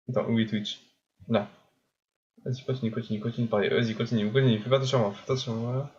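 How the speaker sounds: normal talking voice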